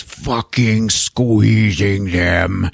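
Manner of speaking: very slow, drawn-out straining delivery; strained squeezing. Retold or imitated. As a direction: drag the words out as if squeezing hard. Narrator acts out squeezing glass.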